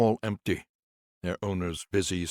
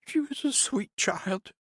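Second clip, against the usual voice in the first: much higher, slow, tender nurse's voice; wobbly smile; slower than usual. Acted. Nurse fondly remembers Navi to Conrad, higher slow voice. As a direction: smile through the grief, slowly. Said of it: "with a wobbly smile".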